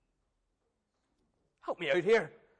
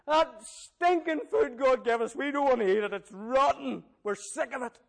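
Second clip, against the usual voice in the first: whinging Israelites; 4 semitones higher, 3 dB louder than usual. Acted. Israelites moaning about the manna.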